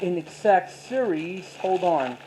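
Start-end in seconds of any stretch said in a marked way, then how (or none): none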